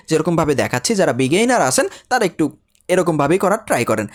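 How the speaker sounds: ordinary talk, nothing marked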